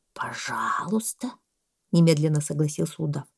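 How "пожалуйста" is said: low, soft, slow consent; agreeable boa. A narrator's reading. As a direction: grant it lazily, drawn out. (удав, низко, тихо, протяжно)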